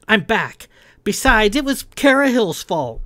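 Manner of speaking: loud, high-pitched objection; indignant protest. A narrator's reading. Kai pleading her case to the captain, indignant. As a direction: argue back hotly, pitch climbing.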